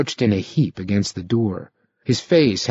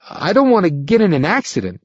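troubled; 7 semitones higher than usual. A narrator's reading. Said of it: "warned in a troubled voice".

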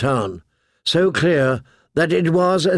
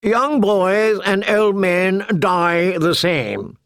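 slow, higher cold reply; cold knight; 6 semitones higher, slower than usual. A narrator's reading. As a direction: say it without caring. Sir Amory replies.

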